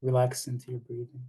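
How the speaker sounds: unhurried meditation guidance with long pauses; calm guide giving breathing cues in short, spaced phrases; guided meditation. Acted. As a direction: lead them gently into relaxation, leaving space between phrases.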